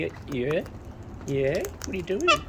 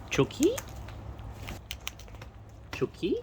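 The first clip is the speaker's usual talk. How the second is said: high, coaxing bird call; high-pitched pet call; 9 semitones higher, 8 dB quieter than usual. Real, not acted. Calling a bird to come.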